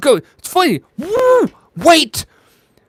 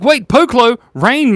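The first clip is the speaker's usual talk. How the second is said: surprised reading; 11 semitones higher, 8 dB louder than usual. Real, not acted. Reads funny chat request.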